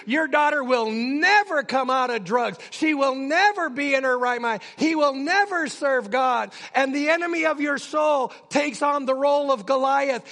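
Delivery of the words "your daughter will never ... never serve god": raised, loud preacher's taunt; shouting. Acted. The enemy shouts at a parent, loud taunting. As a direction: shout it at them, hammering each NEVER. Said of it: "Sometimes the enemy shouts to you".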